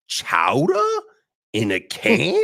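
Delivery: a light Northeast accent, mock disbelief; lightly voiced Northeast accent, "Chowder in a can?" rising. Roommate [name] mocking canned chowder, light Northeast accent. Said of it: "total Northeast accent".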